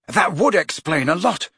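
ordinary delivery, nothing marked

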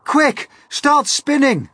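high, loud, drawn-out command; yelled order at high pitch; urgent yell. A narrator's reading. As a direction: shout the order slowly and urgently. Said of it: "yelled James".

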